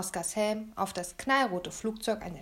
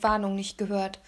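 usual speaking voice

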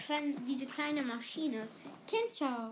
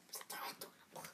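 whispering; 16 dB quieter than usual. Real, not acted. Whispered aside.

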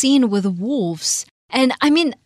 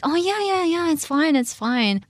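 a guy brushing it off, much higher; high, airy "it's fine" guy; breezy guy voice; 9 semitones higher than usual. Retold or imitated. Mocking the movie guy who says it's fine, high and breezy. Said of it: "the guy's like".

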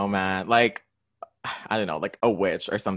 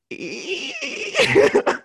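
squeaky door noise; 5 dB louder than usual. Shown as a demonstration. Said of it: "I could try to do a, a squeaky door".

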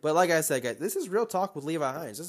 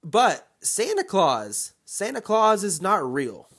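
normal talking voice

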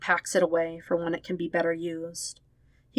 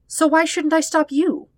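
much higher, louder demand; pressing challenge; 10 semitones higher, 7 dB louder than usual. Acted. Sister demanding why she shouldn't stop him, loud and high. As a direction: push him hard for an answer.

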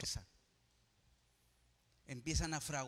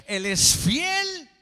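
high, loud, slow proclamation; preacher shouts to the congregation; shouted declaration; 10 semitones higher, 18 dB louder, much slower than usual. Real, not acted. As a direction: belt it out triumphantly.

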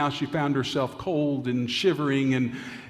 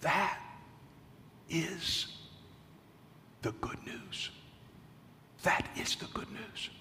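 hushed, breathy whisper of awe; preacher whispering the good news; whispered emphasis; 14 dB quieter than usual. Real, not acted. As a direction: drop to a whisper to land it. Preacher softly drives home point.

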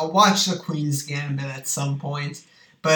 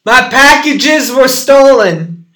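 slow, loud and high, pushing into the phone; talking louder; 9 semitones higher, 13 dB louder, slower than usual. Real, not acted. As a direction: raise the voice so the far listener hears. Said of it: "You gotta talk louder".

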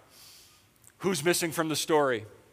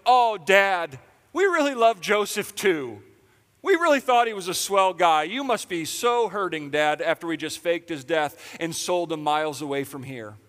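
higher and louder, sugary mock concern; fake sympathy; 3 semitones higher, 6 dB louder than usual. Pastor mocking brothers consoling their father, sugary fake. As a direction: lay the false comfort on thick.

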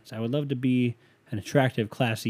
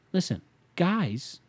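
slow drawn-out call; 6 semitones higher, much slower than usual. Real, not acted.

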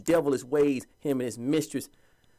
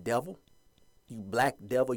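ordinary delivery

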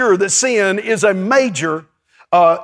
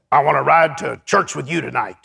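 imitating Sumrall; 6 semitones lower than usual. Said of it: "he talked like this".

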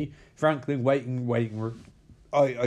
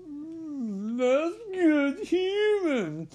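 high, slow mock-savoring murmur; hammed-up high Hannibal savoring his meal; much slower than usual. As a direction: purr it like relishing a delicacy. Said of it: "being like".

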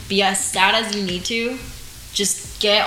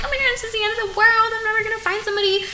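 high, fast melodramatic wail; high, rushed mock despair over being single; 13 semitones higher, much faster than usual. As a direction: play your past self as a drama queen. Mocking her past dramatic self.

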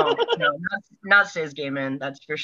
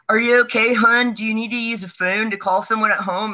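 put-on Baltimore accent; 6 semitones higher than usual. EMT checking on a crash victim, in a broad Baltimore accent. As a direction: imitate a Baltimore local, pitched well up.

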